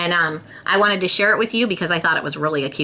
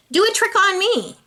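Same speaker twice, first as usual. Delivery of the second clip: little sister; 14 semitones higher than usual. A narrator's reading.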